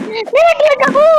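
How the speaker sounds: high yelp while falling; startled cry. Real, not acted. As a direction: shriek as you fall. Falls in game. (gulat na tili)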